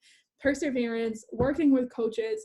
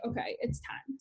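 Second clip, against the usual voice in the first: much softer, inner decision; quiet resolve; 10 dB quieter than usual. Retold or imitated. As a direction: say it softly to yourself. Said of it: "I was like".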